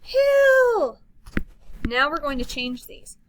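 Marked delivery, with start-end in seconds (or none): 0.0-1.0 slow, louder, joyful; excited reading. Shown as a demonstration. Teacher reading an exclamation to kids, excited. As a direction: sing it out. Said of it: "And last of all".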